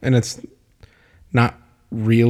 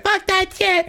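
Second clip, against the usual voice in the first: put-on very high kid voice; high-pitched mock toddler; child cursing. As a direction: squeak it out like a toddler. Kid swearing retold.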